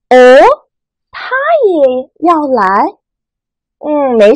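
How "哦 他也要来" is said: 哦 and 来 rising, slow surprised question; uptalk. Shown as a demonstration. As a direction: lift 他也要来 upward in doubt. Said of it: "疑问的语气". (疑问语气，声音往上扬)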